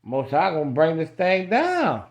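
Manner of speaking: high, loud, driving sermon climax; full-force preaching. Acted. Street preacher crying out to the crowd, loud and high. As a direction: preach it hard, rising to the peak.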